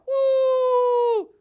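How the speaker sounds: high loud whoop; a loud cheering whoop. Acted. Sheriff cheers himself.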